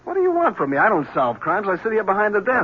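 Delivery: high, exasperated throw-up-the-hands protest; defensive, fed-up lieutenant pushing back. Acted. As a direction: push back like he's fed up with being asked. Lieutenant brushing off reporter's pressure.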